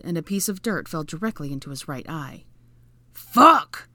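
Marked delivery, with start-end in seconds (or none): 3.1-3.9 very loud, raised-pitch curse; cried out. A narrator's reading. Draco with dirt in his eye, pained loud cry. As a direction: cry out in sudden pain. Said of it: "he cried".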